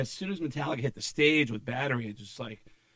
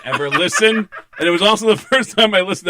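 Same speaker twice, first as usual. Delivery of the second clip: [name] laugh; 7 semitones higher, 10 dB louder than usual. Acted. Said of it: "That was a great [name] laugh".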